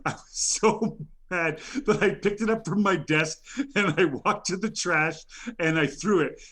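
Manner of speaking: ordinary talk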